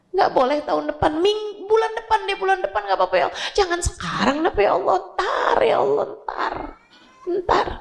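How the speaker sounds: high, begging cry of a dying man; desperate plea to God. Acted. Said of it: "dia akan bilang".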